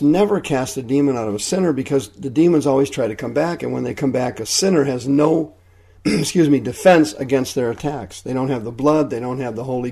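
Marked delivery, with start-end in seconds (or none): none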